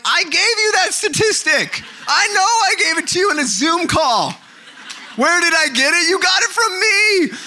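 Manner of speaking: high, loud indignant protest; mock outraged protest. Pastor mimics protesting to his friend Jason, high loud outrage. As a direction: squeal it like a wronged friend. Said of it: "I'm like".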